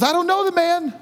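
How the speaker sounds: high-pitched protest; exclaimed, high-voiced disavowal; protesting denial. Peter acted denying Jesus, cornered.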